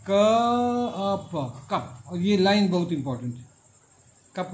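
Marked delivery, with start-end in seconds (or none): 0.1-1.7 drawn-out letter by letter spelling; teacher spelling cup sound by sound; sounded out slowly. Shown as a demonstration. Teacher to students, slow sound-by-sound spelling. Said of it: "कप को phonetic transcription में कैसे कीजिएगा".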